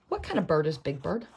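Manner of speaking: ordinary talk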